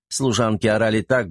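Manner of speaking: ordinary delivery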